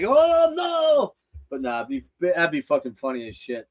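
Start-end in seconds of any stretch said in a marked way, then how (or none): 0.0-1.1 high drawn-out oh no; lightly hammed-up wail of a friend caught out; mock dismay. Luke seeing the host approach in Walmart, high mock wail. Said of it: "Or Luke would be like".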